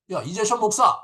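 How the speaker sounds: high, loud stern summons of the pastor; stern call. Retold or imitated. Jesus calling the pastor by title, loud and stern. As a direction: bark the title like a scolding superior. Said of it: "이렇게 하면 마음이".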